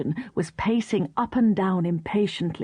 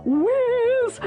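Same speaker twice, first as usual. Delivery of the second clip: high, ringing whiz; gleeful high whiz; 3 dB louder than usual. Acted. Katy whizzing downhill, gleeful high call. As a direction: sing out the speed. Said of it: "went Mister Whisker".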